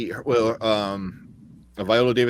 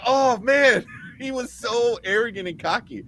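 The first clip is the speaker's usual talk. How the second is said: excited; 10 semitones higher than usual. Real, not acted. Gushing over cocky villain.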